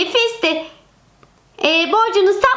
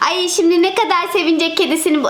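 ordinary talk, nothing marked

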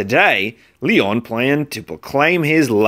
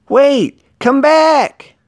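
high, loud call after him; 5 dB louder, much slower than usual. A narrator's reading. Leon shouting after the runaway Valentine, high and loud.

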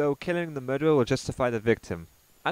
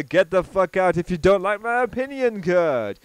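mock quote; 5 semitones higher, 7 dB louder than usual. Quoting the ranter's move mockingly.

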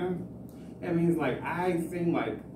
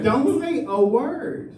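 very slow, a bit louder, each word spaced; slow mock command; 8 semitones higher, 4 dB louder, much slower than usual. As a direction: lay down the rule slowly.

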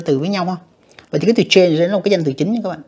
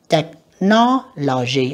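slow Technology with NO raised; second-syllable stress; much slower than usual. Shown as a demonstration. Teacher marking word stress, Technology slowly stressed on no. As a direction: stretch Technology, lifting the voice on no. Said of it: "âm hai nha các bạn".

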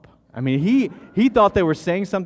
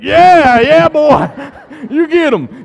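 cheering dad; 13 semitones higher, 15 dB louder, much slower than usual. Retold or imitated. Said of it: "I was like".

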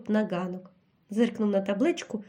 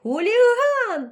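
much higher, louder, slow drawn-out shriek; 10 semitones higher, 5 dB louder, much slower than usual. A narrator's reading. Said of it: "зарепетувала вона". (сова верещить з дупла, дуже високо й гучно)

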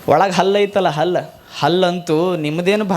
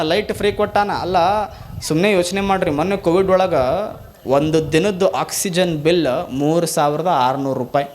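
normal talking voice

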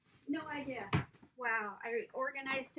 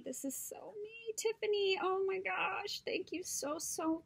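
gushing; 9 semitones higher than usual. Real, not acted.

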